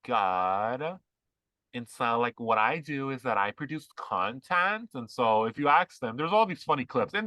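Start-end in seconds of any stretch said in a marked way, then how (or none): 0.1-1.0 very slow mock LA accent. Imitating women at Starbucks, dragged-out LA accent. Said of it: "they have that accent". 2.4-4.9 slow mock LA accent. Mocking content creators, slow put-on LA accent. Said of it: "they have that accent".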